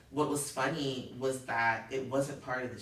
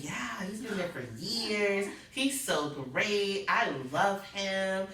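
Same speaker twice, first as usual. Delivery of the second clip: higher, slow, sweet bluffing voice; pretending to know the doctor, slow and higher; fake nurse bluffing; 7 semitones higher, 4 dB louder, slower than usual. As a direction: play along sweetly while faking it. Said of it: "I'm like".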